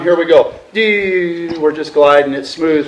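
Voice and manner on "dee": long, held electronic "deee"; imitated steady alarm tone. Shown as a demonstration. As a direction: drone like a variometer on the glide. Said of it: "we got the single arm going".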